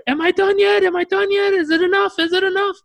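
high-pitched frantic yelling; imitated screaming. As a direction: scream it from a freezing shower. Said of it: "he's screaming from the shower".

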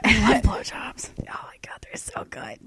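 breathy, unvoiced mock aside; hushed pretend swoon; joking whisper. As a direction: whisper it as a silly confession.

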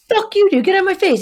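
high, loud angry command; re-enacted heated outburst; retold furious yell. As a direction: shout him back out of your space. Retold face-to-face quarrel.

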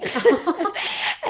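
bursts into a high laugh. Real, not acted. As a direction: laugh at your own mix-up, pitched high. Laughing at her mistake.

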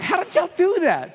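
high-pitched retold disbelief; mock bafflement. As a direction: ask it in comic, high-voiced amazement. Retells his bafflement at the crowd.

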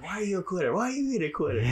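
high teasing singsong taunt; childish high-pitched mockery; mocking taunt. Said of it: "I'm like".

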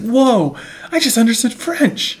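hammed-up "whoa" of discovery; amazed realization. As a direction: play a dim guy stunned by his own ability. Acts out amazed haircut guy.

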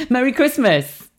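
high-pitched, sugary-sweet cheer; retold cheery smile; smiley greeting. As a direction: greet them with a big audible smile. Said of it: "I smiled and I went".

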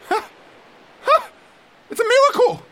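high, loud cry of joy; overjoyed at a miracle; ecstatic cry. Acted.

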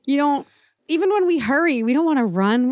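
ordinary delivery

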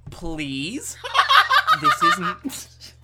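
character plea collapsing into laughter; Austin voice. Acted. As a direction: try the pleading voice, break up laughing. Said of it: "I love your Austin voice".

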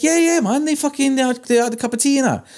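excited high mock voice of his mate; squeaky, hyped-up retelling of the mate; imitating Brett. Tattooist mimics shop owner bragging, high excited voice. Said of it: "He's like".

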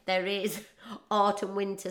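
normal talking voice

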